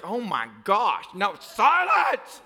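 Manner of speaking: slow gasp building to drawn-out shout; mock shocked outburst. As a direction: stretch it into a hammed-up cry. Mock shocked onlookers, then command.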